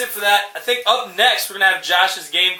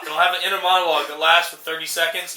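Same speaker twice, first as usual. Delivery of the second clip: normal talking voice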